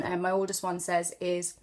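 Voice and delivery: ordinary talk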